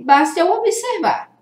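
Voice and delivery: ordinary talk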